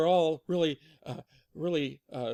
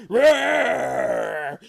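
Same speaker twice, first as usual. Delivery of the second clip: mock angry growling roar; loud bear roar; 4 semitones higher, 10 dB louder than usual. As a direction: roar like a cross father bear. Papa Bear's roar.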